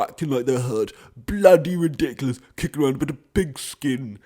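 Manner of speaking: hammed-up disdain for grown men kicking a ball; mocking sneer at football fans. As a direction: sneer like a snobby critic of football. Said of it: "I just got a bit aggressive there".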